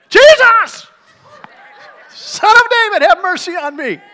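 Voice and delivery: very high, very loud desperate scream; ramped-up cry. Retold or imitated. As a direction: scream it with everything he has. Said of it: "So he just ramps it up".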